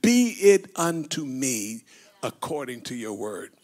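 softly voiced Mary accepting; Mary's surrender. Mary's solemn answer voiced.

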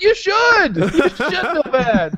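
high-pitched playful reproach; mock scolding. Mock scolding in retold exchange.

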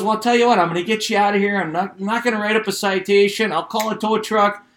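a hammed-up cop doing a favor; cheery cop. Cop cheerfully lets him go.

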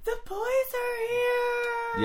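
squealing, overjoyed housewife; excited high-pitched wife. Acted. Wife excitedly greets the boys.

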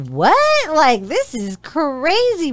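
high-pitched, drawn-out disbelief; retold "what?!" swooping way up; incredulous. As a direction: stretch it out in amused astonishment. Reacting to being charged to hang.

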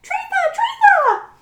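very high, excited squeaky cries; tiny squeaky mouse. Acted. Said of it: "I'll do my best mousy voice".

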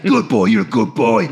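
low put-on voice praising a dog; dog owner praising. Imitating the ex-soldier praising his dog, low put-on voice.

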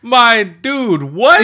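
loud, high disbelief; disbelieving outcry. Real, not acted. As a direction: blurt it out in shock. Shocked by three hours claim.

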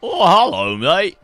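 loud, high mock Australian greeting; hammed-up Aussie hello; cheery fake Australian accent. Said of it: "What accent is that?".

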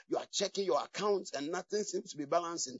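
ordinary talk, nothing marked

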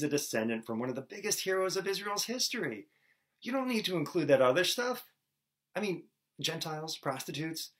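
high-pitched mock objection; acted protest. An objector tells Matthew to drop the embarrassing additions, mock protest.

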